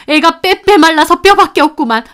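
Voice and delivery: loud, high scornful rebuttal; furious grandmother; angry retort. Acted. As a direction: snap back in outrage.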